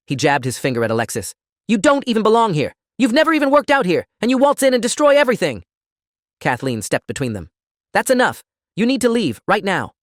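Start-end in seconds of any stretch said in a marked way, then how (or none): 1.7-5.6 high, loud accusing tirade; shrieked, pitched-up rant; furious screaming. A narrator's reading. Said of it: "Derek screamed it". 7.9-9.9 high, raised-voice command; urgent, pitched-up demand to leave; forceful order. A narrator's reading. Manager steps in, orders him out.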